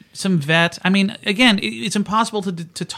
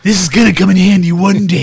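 tough guy quote; 7 dB louder than usual. Retold or imitated.

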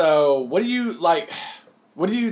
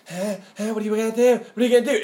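taunting guy; 5 semitones higher, much faster than usual. Retold or imitated. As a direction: imitate someone goading for a fight. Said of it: "And the guy was like".

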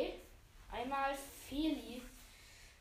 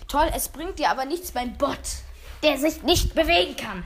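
much louder, exasperated retort; loud sarcastic objection; 13 dB louder than usual. Real, not acted. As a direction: throw the objection out at nearly a shout. Sarcastic objection during game dispute. (lauter, genervter Einwand)

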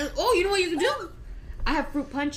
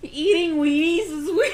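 laughing; 5 semitones higher, 7 dB louder than usual. Real, not acted. Laughing while high.

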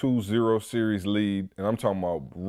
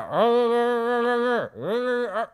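high, slow, garbled uh sounds with mouth wide open; can't talk, jaw stuck open at the dentist; mouth held open; 12 semitones higher, slower than usual. Retold or imitated. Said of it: "I'm in the chair like".

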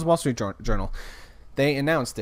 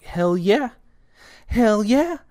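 higher, louder excited cheer; 9 semitones higher, 7 dB louder, much slower than usual. Retold or imitated. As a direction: cheer it out loud, pumped. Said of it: "I was like".

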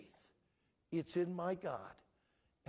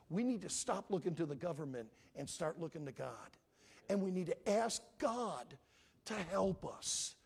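normal talking voice

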